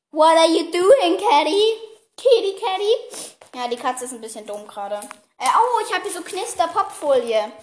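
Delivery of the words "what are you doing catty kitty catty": high, drawn-out pet-talk voice; talking to cat. Real, not acted. Talking to the cat on the floor, high cooing. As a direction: coo slowly up high at a pet.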